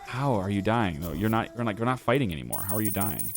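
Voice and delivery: normal talking voice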